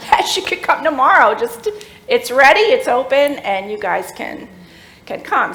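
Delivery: loud and high, laughing the words out; laughing yes. Real, not acted. Welcoming the guest to come tomorrow, laughing loudly.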